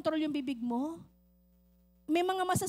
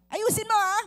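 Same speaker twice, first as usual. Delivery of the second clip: stern warning; 6 semitones higher, 8 dB louder than usual. Acted. Wife warns husband.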